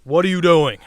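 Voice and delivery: loud, challenging cop, lightly acted; police officer. Officer to British driver at the window, loud challenge. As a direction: imitate an officer confronting a driver. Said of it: "And he's like".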